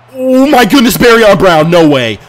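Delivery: shocked yell at the game; disbelieving shout. Real, not acted. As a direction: scream it as the return breaks open. Kick return touchdown against him.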